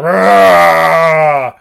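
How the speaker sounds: loud, drawn-out mock monster roar; hammed-up beast yell; alien roar.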